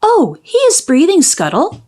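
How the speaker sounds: high, glad burst of relief; relieved cry. Acted. Mermaid telling the seagull the prince breathes, glad cry. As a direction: cry out with joy that he lives. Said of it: "she cried".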